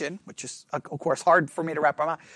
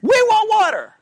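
put-on high, childish whining demand; whiny complaint; 12 semitones higher, 5 dB louder than usual. Israelites whining at Moses for water. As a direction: whine it like a petulant crowd.

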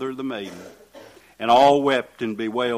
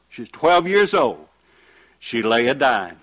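ordinary talk, nothing marked

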